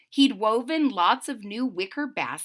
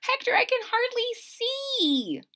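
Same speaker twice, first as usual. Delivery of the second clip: high voice; 13 semitones higher than usual. Acted. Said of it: "said Francine".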